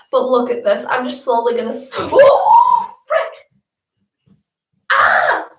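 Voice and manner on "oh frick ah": sudden high cry of alarm; startled yelp. Real, not acted. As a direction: yelp as you slip and fall. Slipping and falling in tub.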